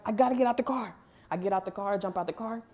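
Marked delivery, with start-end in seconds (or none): 0.0-1.0 higher and louder, panicked and urgent; retold panic, escaping the car; panicked outburst. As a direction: blurt it in panic. Panicking, jumping out of vehicle.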